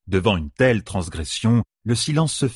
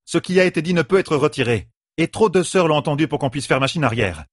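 higher-pitched, mildly projected argument; raised to carry; 7 semitones higher than usual. A narrator's reading. A sister argues over the murmurs, voice raised. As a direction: insist over a murmuring crowd. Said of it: "Assez fort pour être entendu".

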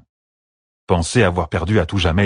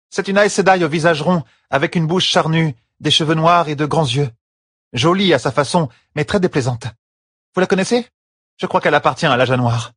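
high-pitched character voice of the wife; Fail, earnest and animated; 10 semitones higher than usual. Acted. Fail describes Galina to the green sister, earnest and high. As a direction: describe her with growing distaste.

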